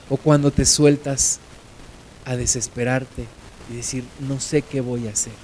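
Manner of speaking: low, soft, compassionate listing; tender hush. Real, not acted. Tender consolation to the listeners.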